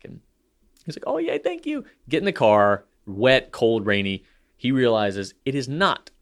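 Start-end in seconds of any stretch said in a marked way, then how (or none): 1.1-1.8 put-on much higher, soft kid voice; a small boy's breathless thanks; imitating grateful kid. Dad mimics his son thanking him for the gift, high kid voice.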